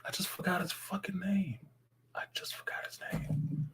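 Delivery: whispered under his breath, annoyed at himself; frustrated whisper. Real, not acted. Streamer whispering to himself as the name escapes him, frustrated whisper. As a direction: mutter it to yourself in a whisper. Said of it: "whispers to himself after forgetting".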